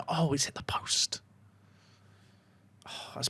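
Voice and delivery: hushed whisper of disbelief. Real, not acted. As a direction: breathe it out in a whisper. Near miss on corner.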